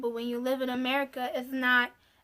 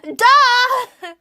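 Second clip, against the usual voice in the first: high, loud "Duh!"; loud mocking duh; 10 semitones higher, 11 dB louder than usual. Real, not acted. Mocking the aunties' logic with a loud high duh. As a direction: fling it out like it's obvious.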